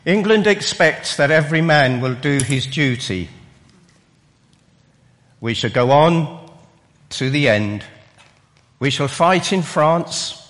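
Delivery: rousing wartime speech delivery; oratory. Acted. Preacher opening the sermon with rousing wartime lines, declaimed. Declaims famous quotes as opener.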